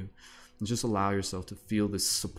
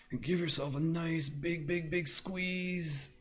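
high cooing squeeze; 9 semitones higher, slower than usual. Acted. Guiding a self-hug.

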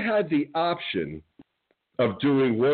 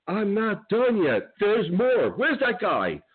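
taunting; 9 semitones higher than usual. Real, not acted. Taunting his enemies.

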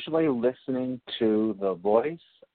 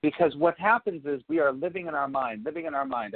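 ordinary talk, nothing marked

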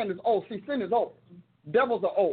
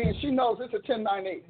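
ordinary delivery

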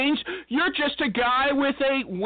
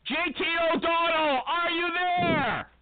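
calling out; 7 semitones higher than usual. Acted. Host calls guest on the line.

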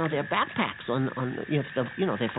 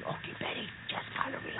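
soft whisper, much softer; 11 dB quieter than usual. Acted. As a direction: breathe it so nobody hears. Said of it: "we gotta be real quiet now".

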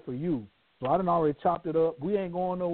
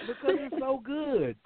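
drawn-out delight; 9 semitones higher than usual. Retold or imitated. Savouring something tasty, drawn out.

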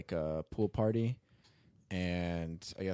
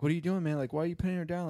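higher-pitched imitation of kids challenging a cop; protesting kids; 8 semitones higher, much faster than usual. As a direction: retell it as a raised-voice protest. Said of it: "were like".

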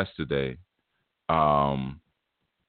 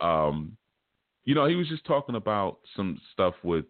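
usual speaking voice

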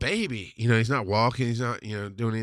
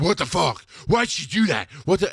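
retold disbelief, pitched up; shocked outrage; 4 semitones higher, 3 dB louder than usual. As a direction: replay stunned indignation, higher voice. Retold shock after the incident.

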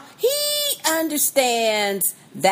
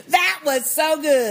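ordinary talk, nothing marked

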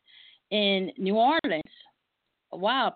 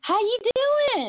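drawling greeting; 13 semitones higher, 3 dB louder, much slower than usual. Acted. Greeting guest in big drawl.

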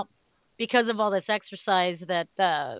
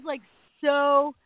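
ordinary delivery

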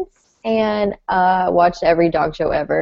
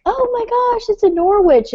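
high squeal of recognition; delighted gasp spotting a rare breed; excited; 13 semitones higher, 3 dB louder than usual. Retold or imitated. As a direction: gush at the dog, pitched way up. Excited dog recognition quote.

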